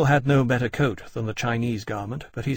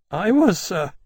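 put-on higher, louder, frightened boy; trembling; 8 semitones higher, 6 dB louder than usual. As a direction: voice cracking with fear. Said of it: "in a trembling voice which cracked mid-word".

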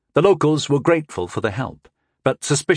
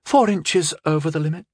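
anxious smirk; 5 semitones higher than usual. A narrator's reading. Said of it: "she smirked anxiously".

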